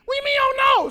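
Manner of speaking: high, loud panicked cry; panicked wife. Retold or imitated. Wife panicking about the sermon, high and loud. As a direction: squeal it in a panic. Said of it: "She's panicking".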